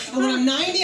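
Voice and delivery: usual speaking voice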